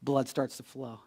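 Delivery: very soft, low, reverent delivery; hushed storytelling. Acted. Jesus waking a child at her bedside, hushed storytelling. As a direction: drop almost to a whisper, tenderly.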